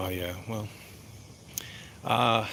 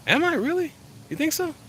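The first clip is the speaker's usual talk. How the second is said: flattered surprise. Real, not acted. Flattered by a chat compliment.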